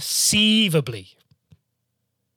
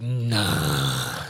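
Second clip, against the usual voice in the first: low, soft, dragged-out no; dismissive refusal; 11 semitones lower, 5 dB quieter than usual. Retold or imitated. Friend refusing to let her through, low drawn refusal. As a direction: imitate a stubborn refusal down low.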